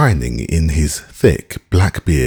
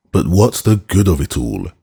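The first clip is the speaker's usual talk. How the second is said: plain reading voice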